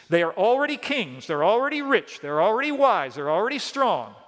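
lightly sarcastic, emphatic listing; ironic. Retold or imitated. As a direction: list their boasts in high, sing-song irony. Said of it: "Paul's speaking ironically here".

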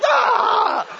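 high, loud mock yelp of fright; imitating his own startled scream. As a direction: yelp as if jolted at the wheel. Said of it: "I'll be like".

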